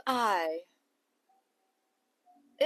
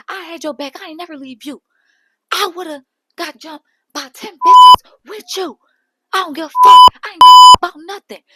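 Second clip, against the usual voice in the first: Rolly shouting, higher and much louder; imitated angry yelling; 7 semitones higher, 17 dB louder than usual. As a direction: scream that you had her back.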